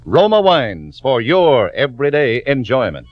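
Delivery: ordinary talk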